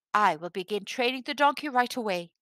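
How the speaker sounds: ordinary delivery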